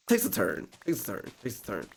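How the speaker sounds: dropping to a hush; fading teaser. Real, not acted. Vlogger teasing the twist, fading hush.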